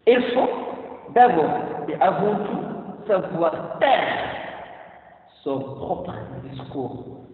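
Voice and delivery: slow and weighty, spaced out; slow, deliberate maxim. Shown as a demonstration. Teacher stating the key rule to the class, slow and weighty. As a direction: lay down the rule slowly. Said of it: "slowed maxim delivery".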